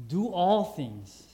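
"all" hit hard and slow in "Do all things"; stress on all. Shown as a demonstration. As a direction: lean slowly on "all". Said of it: "doesn't say some".

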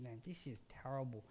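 low, hushed, miserable; a muttered, pained thought; groaning inner thought. Retold or imitated. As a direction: mutter it to yourself in pain. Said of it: "I'm thinking".

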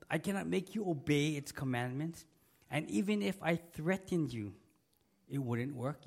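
ordinary talk

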